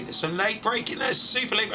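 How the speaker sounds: excited live shout of danger on track; alarmed race call. Real, not acted. Commentator to viewers as late braking threatens carnage, alarmed.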